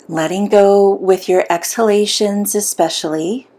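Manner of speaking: unhurried meditation guide with long pauses; soothing, measured visualization voice; guided meditation. Acted. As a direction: guide them gently inward, leaving long silences.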